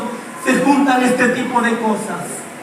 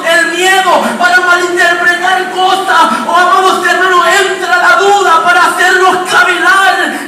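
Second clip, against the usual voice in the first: rising pulpit fervour; preaching at full force; 4 semitones higher than usual. Acted. Preacher warning the congregation about doubt, preaching at full force.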